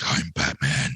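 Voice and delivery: low, put-on Batman voice. Mom quoting her little son playing Batman, low put-on voice. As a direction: drop the pitch to play a kid's Batman. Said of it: "he's doing the whole voice".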